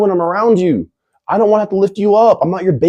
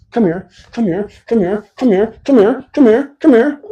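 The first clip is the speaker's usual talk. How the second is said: rising come here; 4 semitones higher than usual. Real, not acted. Calling someone over, rising.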